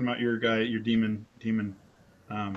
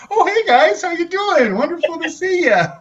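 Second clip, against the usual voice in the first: much higher, louder, sugary-friendly neighbor voice; Mister Rogers; 11 dB louder than usual. Retold or imitated. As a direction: gush like a kindly TV host greeting kids. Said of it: "immediately becomes like Mister Rogers".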